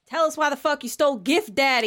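acted tough-guy threat; angry demand. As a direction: interrogate the villain sharply. Player threatens cowering Grinch.

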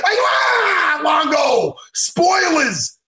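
hammed-up outrage, half laughing; mock outraged yell. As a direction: yell at him for spoiling the ending. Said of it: "and I go".

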